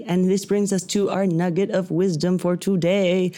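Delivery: ordinary talk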